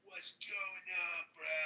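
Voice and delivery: high, loud, drawn-out put-on street accent; mock gangsta accent. Mocking Clubhouse talkers greeting a room, exaggerated gangsta accent. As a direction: stretch it out like a wannabe gangsta. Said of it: "you don't have to be like".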